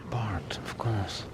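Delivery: soft unvoiced whisper. Real, not acted. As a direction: breathe it out, no voice. Quiet aside while feeding.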